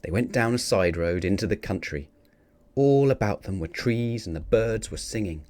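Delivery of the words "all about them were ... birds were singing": ordinary delivery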